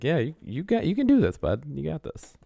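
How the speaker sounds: high-pitched mock pep talk; gently hammed-up encouragement; very encouraging teacher. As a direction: imitate a teacher coaxing a small child. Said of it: "being very encouraging to [name]".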